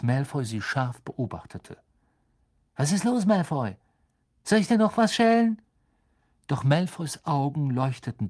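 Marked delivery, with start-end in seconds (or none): 2.8-5.6 hohe, gereizte Jungenstimme; gereizt. A narrator's reading. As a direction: den Gegner herausfordern, gegen Ende lauter. Ron fährt Malfoy an. (gereizt und patzig)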